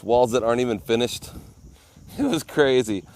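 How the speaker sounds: ordinary talk, nothing marked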